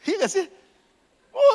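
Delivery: ordinary delivery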